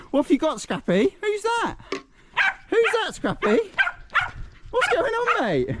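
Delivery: very high singsong questions; pet-owner voice, pitched right up; high-pitched dog talk. Real, not acted. Calls playfully to his dog.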